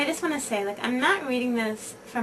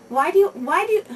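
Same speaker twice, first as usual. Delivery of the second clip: higher, louder nagging reproach; berating husband; 8 semitones higher, 8 dB louder than usual. Retold or imitated. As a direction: scold your wife over the burnt rice. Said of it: "Your husband berates you".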